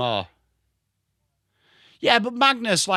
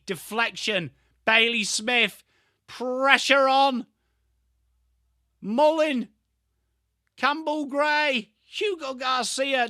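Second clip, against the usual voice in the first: stretched-out, rising football call, climbing higher in excitement; play-by-play commentary; 6 semitones higher, slower than usual. Acted. Streamer mimics commentator calling a frantic footy match, excited play-by-play. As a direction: call the action as it builds, then settle.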